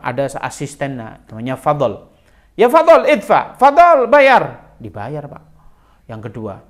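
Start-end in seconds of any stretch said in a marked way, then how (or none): 2.6-4.7 high, loud call to the assistant; calling out an order. Retold or imitated. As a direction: call Fadl across the room, loud and high. Prophet orders assistant to pay. (memanggil Fadl dengan keras)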